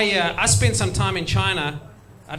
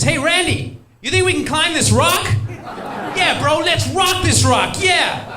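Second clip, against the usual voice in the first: much higher, loud bro shout; dumb American bro; 10 semitones higher, 5 dB louder, slower than usual. Retold or imitated. American tourist yelling to his buddy about climbing the rock, loud bro voice. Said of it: "turns to his buddy and says".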